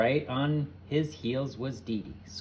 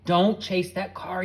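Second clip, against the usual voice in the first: loud, slow high yell; 8 semitones higher, 9 dB louder, slower than usual. A narrator's reading. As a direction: shout the warning. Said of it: "yelled Carrie".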